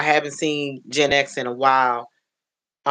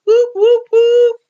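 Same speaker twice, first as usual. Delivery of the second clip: high, loud whoops; celebratory whooping; 10 dB louder than usual. Real, not acted. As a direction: cheer the new dad with ringing whoops. Cheers for a new father.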